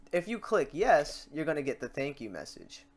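ordinary delivery

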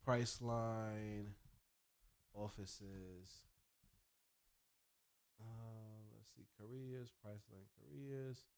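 very soft, drawn-out murmur while searching; absent-minded self-talk. Real, not acted. Vlogger looking up Priceline offices, absently talking to himself. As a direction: murmur the search words to yourself.

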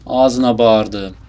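loud, drawn-out cursing; exasperated yelling. Real, not acted. Player cursing an opponent, exasperated yell. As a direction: yell it out slowly at the game. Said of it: "Bağıracağım şimdi ya".

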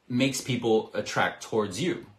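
ordinary talk

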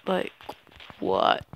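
ordinary talk

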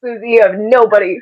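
ordinary delivery

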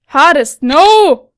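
loud dismayed no; loud protest. Real, not acted. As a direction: shout no in dismay. Sees hardest mode, protests.

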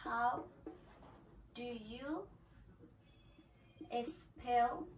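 each word set apart by long pauses; slow, word by word. Shown as a demonstration. Teacher modelling for students, slowly word by word. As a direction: break the question up for beginners. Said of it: "Another expression is".

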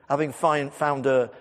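normal talking voice

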